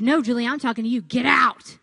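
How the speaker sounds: loud shouted order, rising hard; retold yell. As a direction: bark it like an angry trainer. Trainer yelling her off treadmill.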